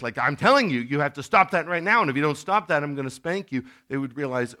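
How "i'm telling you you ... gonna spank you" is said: loud, higher, furious warning; a dad finally losing his temper with his kids; angry shout. Retold or imitated. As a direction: yell with veins bulging in the neck. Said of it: "veins would start to come out of the neck".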